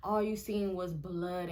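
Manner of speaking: usual speaking voice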